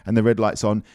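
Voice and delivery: usual speaking voice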